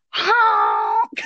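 high, silly honking; silly clown honk. Retold or imitated. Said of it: "I go".

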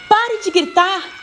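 high, drawn-out desperate plea; imploring. A narrator's reading. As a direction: beg him to stop, stretching the words. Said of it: "eu implorava". (súplica aguda e arrastada)

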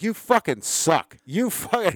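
mocking jeer. Mocking drunk friend's jab.

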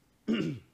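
hoarse throat clear. Real, not acted. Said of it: "Losing my voice".

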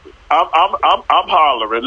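normal talking voice

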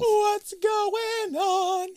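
very slow, high, loud spooky drawl; spooky drawn-out voice. Pretend. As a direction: stretch it like a ghost. Echoing spooky line.

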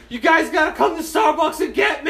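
high, desperate-sounding mock shout; calling for rescue. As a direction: yell back to friends in the driveway. Calls to friends from moving jeep.

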